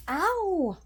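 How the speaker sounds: high sharp ow; sudden yelp of pain. Real, not acted. Vlogger yelps in pain mid-talk, sudden high cry. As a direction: yelp as if something hurt.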